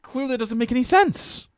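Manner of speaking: very high-pitched disbelief; exasperated. Real, not acted. Streamer ending a rant on forward settling, exasperated.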